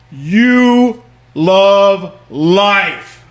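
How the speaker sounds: loud, high, long-drawn mock yell; retold yell. Drunk-seeming guy on a plane, imitated loud yell. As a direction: stretch each word into a big imitated holler. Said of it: "the guy yells".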